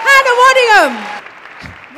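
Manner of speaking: big, slow showbiz announcement of a name; drawn-out announcer call. Acted. Host announcing the star to the theatre crowd, drawn out and loud. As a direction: stretch the star's name out to the crowd.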